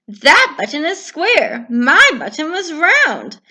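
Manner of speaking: complain, frustrated; cross Toad; upset Toad. Acted.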